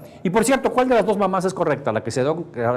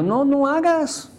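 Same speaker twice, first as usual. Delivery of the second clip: higher, slow pleading no; mock alarmed husband; 10 semitones higher, slower than usual. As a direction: beg the wife not to cook. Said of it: "Y digo". (esposo pidiendo a Elsa que no cocine, alarmado)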